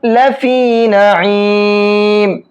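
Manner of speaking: لفی recited very slowly with the ی stretched one alif long; Quranic recitation. Shown as a demonstration. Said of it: "ایک الف کے برابر کھینچتے ہیں". (تجوید کے ساتھ آہستہ تلاوت، ی کو کھینچ کر)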